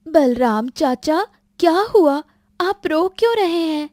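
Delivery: put-on high little-girl voice, alarmed; child anxiously questioning a crying uncle; worried child. (घबराई बच्ची की ऊँची आवाज़)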